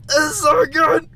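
high delighted shout; excited cry. Real, not acted. Player thrilled by the game, crying out high. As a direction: squeal with real delight.

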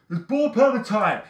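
high, loud, hyped-up yell; screaming catchphrase. Shown as a demonstration. Showing guys what to yell before getting dressed, screaming. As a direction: scream it like a party rallying cry. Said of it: "I guys want you screaming".

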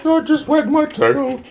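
high, slow voice for the dog; squeaky high pretend pup voice; dog's voice. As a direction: speak for the dog as it wags. Voicing the dog's reply.

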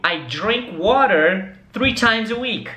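slow and careful, words spaced out; slow model sentence. Shown as a demonstration. Teacher asks students to repeat, slow and clear. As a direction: say it slowly so they can repeat. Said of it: "repete comigo".

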